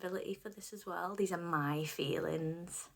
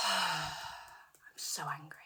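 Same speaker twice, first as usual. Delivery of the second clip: sighed, slow whisper of anger; 4 dB quieter, much slower than usual. Acted. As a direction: breathe it out after a sigh. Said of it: "I'm feeling all this rage".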